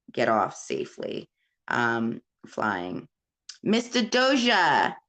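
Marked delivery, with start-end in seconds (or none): 3.6-4.9 high, swooping hail to a viewer; playful call-out. Real, not acted. Greeting a viewer by name.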